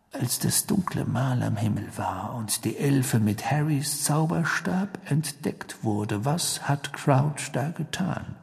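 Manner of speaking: soft, slow, deliberate question; Sirius, intent. Acted. Sirius silences Ron and questions further, intent. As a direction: question slowly in a hushed voice.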